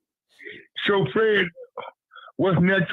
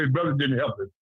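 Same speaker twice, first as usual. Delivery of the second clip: ordinary talk, nothing marked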